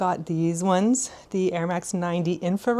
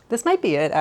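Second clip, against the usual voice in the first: excited; 5 semitones higher, 6 dB louder, faster than usual. Real, not acted. Hopeful verdict on the jeans.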